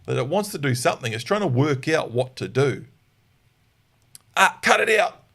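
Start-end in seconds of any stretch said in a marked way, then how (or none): 4.3-5.2 high, loud "Ah, cut it out"; sharp correction. Shown as a demonstration. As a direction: bark it to stop the dog. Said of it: "and then again".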